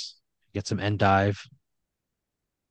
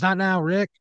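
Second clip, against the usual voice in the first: high-pitched call, only mildly raised; mock shout in a higher voice; 9 semitones higher than usual. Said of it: "like, shout it out".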